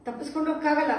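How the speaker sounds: ordinary talk, nothing marked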